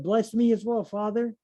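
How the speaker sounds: high, pleading cry, mildly acted; bitter cry. A narrator's reading. Reading Esau begging his father, in a mild pleading cry. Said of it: "cried out with an exceedingly great and bitter cry".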